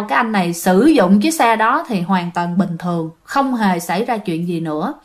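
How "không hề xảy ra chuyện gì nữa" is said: ordinary delivery, nothing marked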